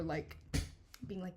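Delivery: usual speaking voice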